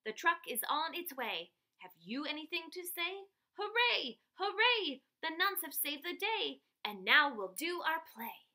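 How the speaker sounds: bouncy chanted rhyme with loud cheers; sing-song it like a victory verse; cheerful rhyme. Acted. Girl writing a journal verse, bouncy chanted cheer. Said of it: "I wrote in my journal".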